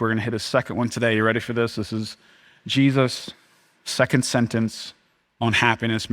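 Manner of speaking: ordinary delivery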